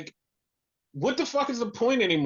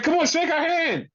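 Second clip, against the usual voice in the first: much higher mock pleading; imitating Cash; 10 semitones higher, 4 dB louder than usual. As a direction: imitate a wrestler urging a handshake, high-pitched. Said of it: "And he's like".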